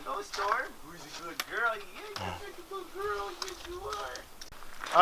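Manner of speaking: soft, high cooing to a dog; talking to a dog. Real, not acted. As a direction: coo softly, pitch way up. Greeting the dog again.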